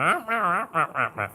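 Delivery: wordless wah-wah grown-up voice, starting high; Charlie Brown's teacher. Retold or imitated. Showing what dogs hear when humans talk, cartoon teacher wah-wah. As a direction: imitate a garbled cartoon teacher droning nonsense. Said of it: "Charlie Brown would listen to his teacher".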